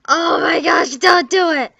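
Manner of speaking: loud, high pleading cry; mock horror. Loud, high mock horror as the cat's owner. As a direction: scream no in mock terror. Playing the horrified victim.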